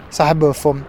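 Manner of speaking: ordinary delivery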